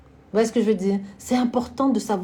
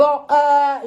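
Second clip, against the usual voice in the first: normal talking voice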